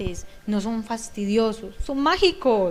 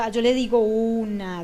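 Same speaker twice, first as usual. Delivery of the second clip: normal talking voice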